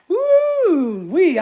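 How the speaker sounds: high, loud woo; excited whoop. Real, not acted. Streamer whooping with joy over clam chowder. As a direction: let out a big happy whoop.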